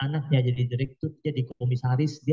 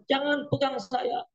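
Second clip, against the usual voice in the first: a preacher imitating a mourner at the grave, pleading high; mock hysterical plea; 9 semitones higher than usual. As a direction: play a grieving mourner who begs to be let go, up high. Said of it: "Dia mengatakan".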